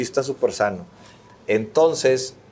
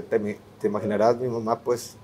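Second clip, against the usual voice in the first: usual speaking voice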